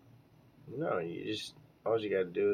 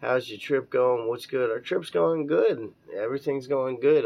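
reading listener text; 5 semitones higher, 6 dB louder than usual. Acted. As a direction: read it out like a DJ.

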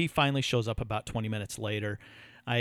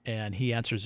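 usual speaking voice

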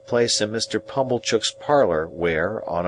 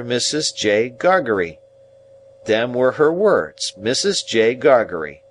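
higher, deliberate rustic explainer; simple, earnest Joe; 5 semitones higher, 3 dB louder than usual. Acted. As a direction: explain it painstakingly, phrase by phrase. Narrator voices Joe explaining.

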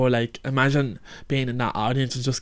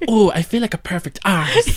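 higher, louder mock character line; TV character impression; 6 semitones higher, 4 dB louder than usual. Quoting a favourite Friends line to a friend, hammed-up impression. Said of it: "when she goes".

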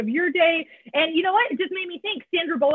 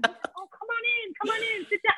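soothing greeter; 9 semitones higher, 5 dB quieter than usual. Retold or imitated. The sober greeter ushers her in softly. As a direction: coax her in gently.

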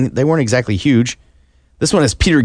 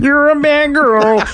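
mock deaf kid voice; 13 semitones higher, slower than usual. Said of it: "I was making dinner going".

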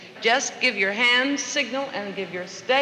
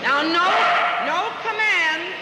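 calling out commands; 8 semitones higher, much slower than usual. Real, not acted. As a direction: call over the whole class. Trainer calling out to class.

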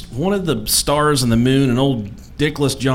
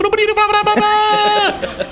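squeaky high nonsense babble; high gibberish babble. Retold or imitated. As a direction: babble high and fast like broken English. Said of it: "And she's like".

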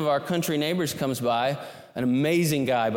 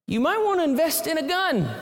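dry neighbor advice; 13 semitones higher than usual. Retold or imitated. The neighbor advising the city guy, mock deadpan. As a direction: deliver the advice like an amused local.

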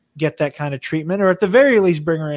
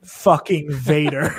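normal talking voice

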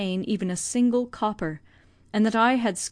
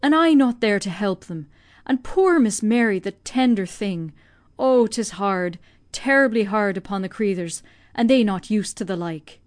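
louder lament, slowing on the tender thing and 'tis hard; grieving old Irish servant; 4 dB louder than usual. Acted. Old servant mourning that she cannot help, Irish lament. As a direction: plead with heaven for the poor lady.

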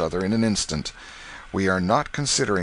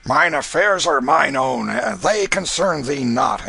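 louder, slow gruff retort; rough reply; 6 semitones higher, 5 dB louder than usual. Acted. Canty shaking off the waterman, rough and curt. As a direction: brush the drunk off roughly. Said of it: "answered Canty roughly".

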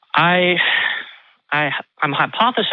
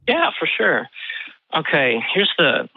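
out of breath; 3 semitones higher than usual. Real, not acted. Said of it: "forgive me if I sound like I'm out of breath".

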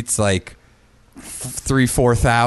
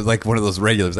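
normal talking voice

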